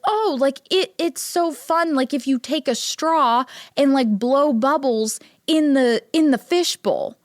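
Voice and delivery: lightly acted grown-up coaxing a child; coaxing a small child. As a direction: sell it to a little kid as great fun. Grandma talking to small child, retold.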